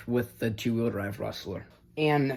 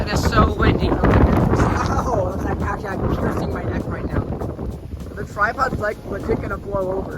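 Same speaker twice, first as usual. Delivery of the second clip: loud, raised voice against the wind; shouting over wind; 8 semitones higher, 6 dB louder than usual. Real, not acted. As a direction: yell to be heard over gusts. Filming outdoors in strong wind.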